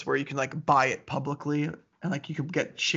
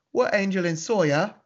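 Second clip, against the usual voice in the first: badly faked British accent greeting the UK; a mock posh English hello, hammed up; clumsy fake British accent; terrible British accent; 4 semitones higher, 4 dB louder than usual. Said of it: "That was a terrible accent".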